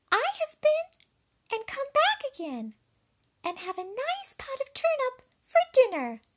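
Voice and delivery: very high, loud squeaky pig; triumphant little pig. A narrator's reading. Pig tells the wolf it beat him, high loud squeak. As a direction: squeak the news out like a piglet. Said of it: "the little pig".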